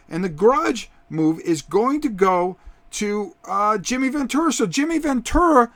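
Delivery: lively ringside play-by-play; wrestling commentator. Acted. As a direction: call the match like a TV announcer.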